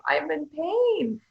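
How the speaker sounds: high, slow, drawn-out complaint of pain; body in pain. Retold or imitated. The body complains to its owner, slow and pained. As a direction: moan it out slowly like a hurting body. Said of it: "when it's screaming at us and going".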